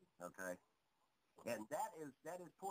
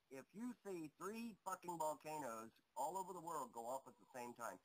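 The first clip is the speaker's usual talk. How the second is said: ordinary talk, nothing marked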